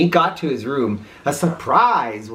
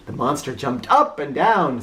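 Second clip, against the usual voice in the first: ordinary delivery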